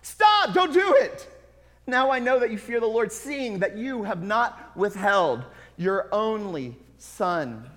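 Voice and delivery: the angel screams at Abraham to stop; angel screaming. Acted. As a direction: cry out to halt the sacrifice. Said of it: "the angel screams".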